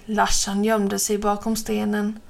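ordinary delivery, nothing marked

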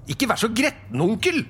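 very high, cheerful coaxing; disarming, smiling. Acted. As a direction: coax grumpy uncle with a smile. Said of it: "smilte Fred avvæpnende". (avvæpnende, lys og smilende stemme)

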